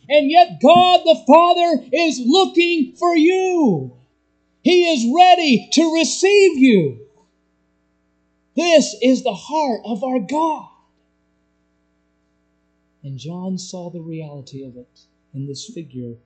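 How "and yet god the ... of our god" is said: loud, high preacher's call; full-force preaching. Acted. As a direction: preach it out to the whole room.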